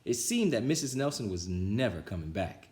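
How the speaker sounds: usual speaking voice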